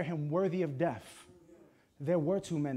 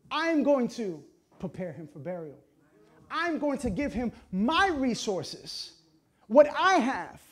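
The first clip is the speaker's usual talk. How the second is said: high, loud, emphatic preaching; raised pulpit voice, pressing hard; preaching at full force; 7 semitones higher, 9 dB louder, slower than usual. Real, not acted. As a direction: drive the point home to the congregation.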